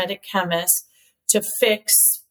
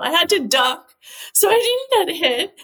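usual speaking voice

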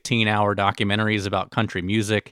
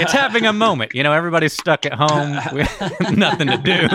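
much higher and louder through laughter; laughing; 7 semitones higher, 5 dB louder than usual. Real, not acted. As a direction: ride the joke while laughing. Keeps the joke going, laughing.

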